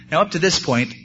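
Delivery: plain reading voice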